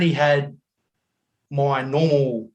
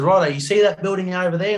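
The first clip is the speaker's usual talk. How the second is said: lightly imitated driver, pitched up; taxi driver; 6 semitones higher, 3 dB louder than usual. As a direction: imitate a cabbie pointing something out. Retold driver pointing out hotel.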